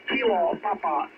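ordinary talk, nothing marked

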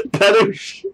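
"Padouche" with a French lilt, rising; mock French. Trying the funny word on stream, mock French. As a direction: try "Padouche" like a posh Frenchman. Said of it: "I like that".

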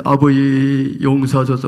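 Jesus pleading. Retold or imitated. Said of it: "하고 피 흘".

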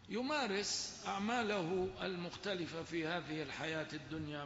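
ordinary delivery

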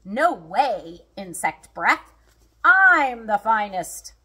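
loud tiger one-upmanship; boastful retort. A narrator's reading. Tigers quarrel over who's finest.

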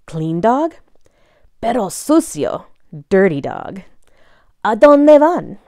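ordinary delivery

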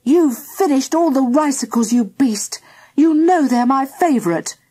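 high, accusing complaint; indignant breakfast-table row; squabbling child. A narrator's reading. Children squabble over breakfast cereal.

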